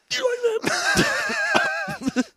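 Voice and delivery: very high, slow drawn-out tease; mock seductive. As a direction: stretch it out high and silly. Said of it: "you're like".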